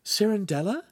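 very high, delighted questions; bright young woman's voice. A narrator's reading. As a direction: chirp with happy surprise. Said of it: "Giulietta said brightly".

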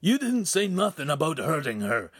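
high-pitched indignant outburst; spouted protest. A narrator's reading. Ugolino confronting the noble over hurting her, high indignant protest. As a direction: object hotly, voice pushed up. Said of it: "Ugolino spouted".

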